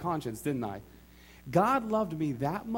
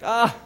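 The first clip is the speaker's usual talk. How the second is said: mock surprised yelp; 7 semitones higher, 10 dB louder than usual. Landlord offers the apartment.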